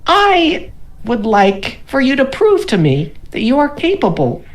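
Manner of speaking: high, slow grandiose gnome voice. Acted. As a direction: proclaim your vision like a pompous gnome. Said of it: "He's like".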